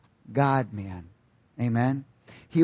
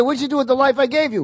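higher, fast, demanding question; voice of the King; 9 semitones higher, 5 dB louder, faster than usual. Retold or imitated. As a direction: confront him as the king on judgment day. Voicing God the king at judgment.

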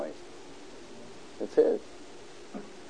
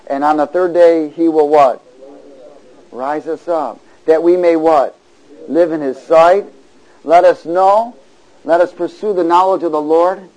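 loud, emphatic declaiming; forceful call-and-response reading; preacher's scripture reading; 4 semitones higher, 10 dB louder than usual. Real, not acted. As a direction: proclaim the verse, pausing between phrases for the congregation. Reads scripture to congregation.

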